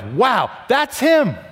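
high, drawn-out shout of wonder; big, emphatic wow; amazed exclamation. Acted. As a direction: let the awe burst out slowly. Every part of you exclaims wow.

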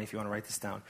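normal talking voice

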